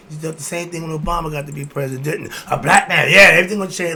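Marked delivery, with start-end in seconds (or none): 2.5-4.0 mocking shout. Mocking voters' Obama hopes.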